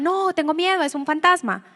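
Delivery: high, loud panicked cry of the disciples; scared disciples shouting at a ghost on the water; frightened cry. Acted. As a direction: cry out as if you've just seen a ghost.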